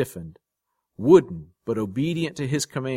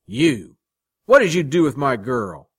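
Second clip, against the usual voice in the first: loud, raised, accusing; angry confrontation; 4 semitones higher, 8 dB louder than usual. A narrator's reading. Man confronting rival over his girl, angry. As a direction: demand an answer loudly.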